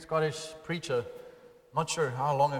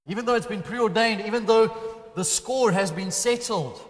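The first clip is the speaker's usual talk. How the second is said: loud, high preacher's build; fervent preaching; 6 semitones higher, 6 dB louder than usual. Acted. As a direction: drive it home to the congregation. Preaching climax.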